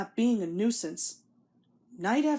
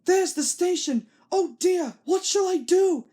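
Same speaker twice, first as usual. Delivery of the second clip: higher, louder panicky cry; alarmed cry; 9 semitones higher, 5 dB louder than usual. A narrator's reading. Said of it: "he cried".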